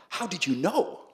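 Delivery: high-pitched astonished question; amazed cops. Retold or imitated. Cops amazed he knew the banknote digits, astonished. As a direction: imitate stunned cops, pitch lifted.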